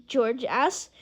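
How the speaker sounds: ordinary delivery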